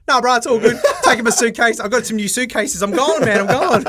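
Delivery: high, loud laughing bro voice; hammed-up careless bro rushing off to Thailand; carefree mock hype. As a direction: laugh it off like nothing matters. Imitating carefree Ben.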